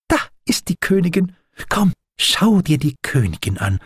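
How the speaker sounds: high, eager exclamation; thrilled. A narrator's reading. Doctor spots the queen bee, thrilled. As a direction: urge a friend to come and look.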